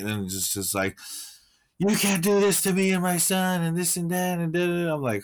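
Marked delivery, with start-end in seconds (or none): 1.8-4.9 higher, entitled rant imitating a Karen; lightly hammed-up angry Karen; mock Karen rant. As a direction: mimic a crazy Karen demanding her rights. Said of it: "this lady turned into some crazy Karen".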